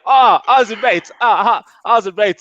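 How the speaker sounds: high, loud mock Australian "Ah, Arjun, mate"; hammed-up Australian greeting; teasing a friend in a bad Australian accent; bad Aussie accent. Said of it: "That's not a very good, uh, Aussie accent there".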